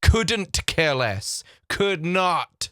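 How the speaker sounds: COULDN'T drawn out with a crisp final T; slow, careful correction. Shown as a demonstration. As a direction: spell out COULDN'T very slowly. Slow correct version after misquote.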